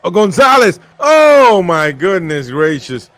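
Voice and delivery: usual speaking voice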